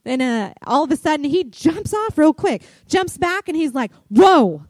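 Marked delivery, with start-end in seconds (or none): 4.1-4.6 loud, startled cry; startled whoa. Retold or imitated. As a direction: jump back and yell in surprise. Son jumps back from deer.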